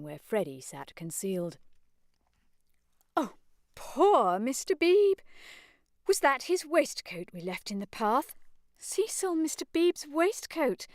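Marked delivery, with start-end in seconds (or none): 3.1-10.9 high, fussy scandalised matron; shocked fussy old lady voice; flustered mother. Acted. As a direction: fluster over the scene.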